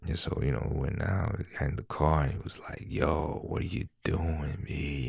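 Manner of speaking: soft mumbling coworker impression; low talker impression. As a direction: imitate a coworker who barely speaks up. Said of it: "That's how he talks".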